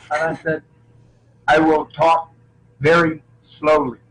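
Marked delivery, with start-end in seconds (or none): 1.5-4.0 slow, halting, deliberate delivery; David Carradine. Acted. Actor showing the studio his Kung Fu accent, slow Carradine impression. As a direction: pause between words, each one measured. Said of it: "I can do the David Carradine".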